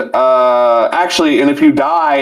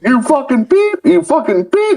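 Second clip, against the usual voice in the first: high silly voice spitting censored insults; cartoonish high-pitched cursing; mock insults. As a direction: squeak the bleeped curses. Mocking Lugo's bleeped insults.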